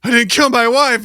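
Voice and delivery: pleading fugitive. Retold or imitated. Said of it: "he's like".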